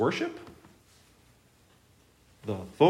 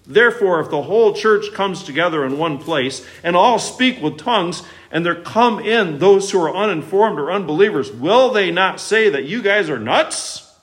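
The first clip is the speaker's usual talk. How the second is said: higher, louder preaching read; forceful scripture reading; 6 semitones higher, 6 dB louder than usual. Acted. Preacher reads verse twenty-three to the church, loud and forceful. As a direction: read it out high and strong to the room.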